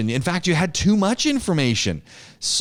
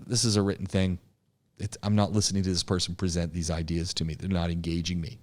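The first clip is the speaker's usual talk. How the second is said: much softer, low, tuned-out mumble; bored listener aside; 8 semitones lower, 7 dB quieter, faster than usual. Shown as a demonstration. Listener tuning out a speech, bored and much softer. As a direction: sound like someone who has stopped listening. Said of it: "They just say".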